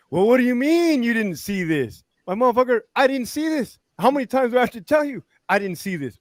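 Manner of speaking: high-pitched, acted exasperation; hammed-up indignant protest; exasperated arguing. Acted investigator and accused officer arguing.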